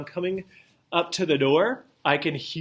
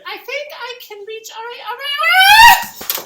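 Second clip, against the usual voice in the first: very high, rising to a strained cry; straining Barbie voice; 11 dB louder than usual. Acted. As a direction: reach and strain, squealing.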